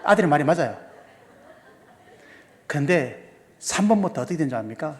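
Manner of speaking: usual speaking voice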